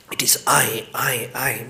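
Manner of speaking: hushed whisper, voice drops away; soft unvoiced whisper of I. Real, not acted. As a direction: breathe it out like a secret. Whispers the core insight.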